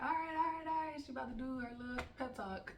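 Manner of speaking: put-on high, loud teasing kids' chorus; kids groaning along. Students teasing the teacher before her pep talk, high mocking. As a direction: mimic students who know the speech by heart. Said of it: "So they're like".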